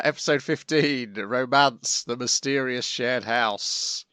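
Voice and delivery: usual speaking voice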